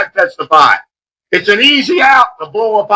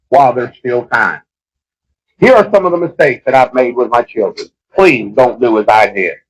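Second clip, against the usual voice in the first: humble confession; 8 semitones lower than usual. Acted. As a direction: lay your pride down and confess gently.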